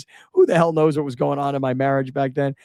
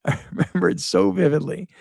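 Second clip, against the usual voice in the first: laughing; 6 semitones higher than usual. Real, not acted.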